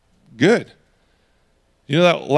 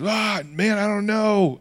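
higher, strained mock exasperation; exasperated denial; 4 semitones higher, 4 dB quieter, slower than usual. Peter denying to a man who asks him, mock exasperated. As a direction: act out a flustered denial, pitched up.

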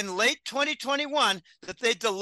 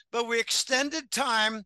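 normal talking voice

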